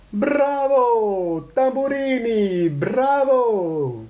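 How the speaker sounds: high, drawn-out cheers; acclaiming. Acted. All the guests greet Tambourini, acclaiming. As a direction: hail the star with swooping bravos. Everyone cheers the singer's entrance.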